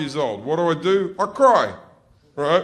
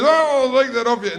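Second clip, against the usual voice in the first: high, loud, fake-casual dad voice; nervous dad; 9 semitones higher, 5 dB louder than usual. Dad taking the vibrator off his son, nervously breezy. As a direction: cover the nerves with loud breeziness. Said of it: "dad when they're nervous".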